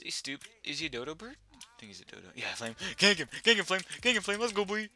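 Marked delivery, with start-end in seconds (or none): 3.0-4.9 high, excited urging; rapid-fire cheering on a teammate; urgent egging on. Real, not acted. Gamer urging teammate Flame to attack, excited egging on.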